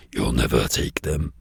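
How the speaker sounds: very deep, slow demon voice; menacing Antichrist. Acted. Antichrist defying Jesus over souls, deep menacing voice. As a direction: boom it low like a dark lord. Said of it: "The Antichrist is there".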